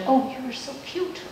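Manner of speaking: cooing, soft, rising to a high squeal on kissable; baby talk. Acted. Adult coos over an adorable little child, soft then very high.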